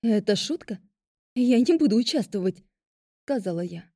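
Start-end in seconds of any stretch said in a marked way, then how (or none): none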